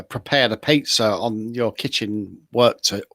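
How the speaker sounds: normal talking voice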